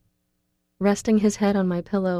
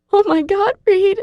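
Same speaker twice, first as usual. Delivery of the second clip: much higher and louder, horrified rasp; rasp in horror; 8 dB louder than usual. A narrator's reading. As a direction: cry out in horror. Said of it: "I rasp in horror".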